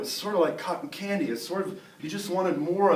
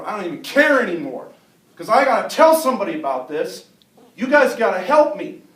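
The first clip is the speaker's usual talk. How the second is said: loud plea, rising higher; desperate; 4 semitones higher, 9 dB louder than usual. Acted. Fleeing kid needs to tell somebody, desperate plea. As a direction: build to an urgent cry for help.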